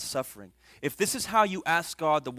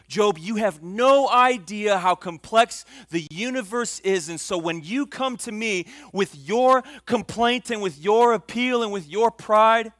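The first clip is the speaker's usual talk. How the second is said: loud, high, slow rebuke; full-force preaching; 7 semitones higher, 10 dB louder than usual. Acted. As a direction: drive each word home from the pulpit.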